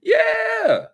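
put-on very high, loud kid cheer; mock children's shriek of joy. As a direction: squeal it like thrilled children. Voicing the excited kids.

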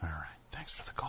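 whispered sign-off aside; hushed aside under the breath; whispering. Real, not acted. Whispered aside on radio show.

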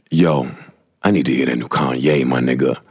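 low, laid-back homie voice; slangy friend voice. Retold or imitated. Imitating the friend asking to hear [name], low and laid-back. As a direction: drop low and casual like the friend. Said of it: "he's not saying".

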